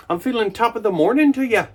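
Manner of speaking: a high, hammed-up Irish lilt; bad Irish accent. Fresh from the shower, he hams a bad Irish accent. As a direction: top of the morning in a corny Irish brogue. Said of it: "That's probably a terrible accent".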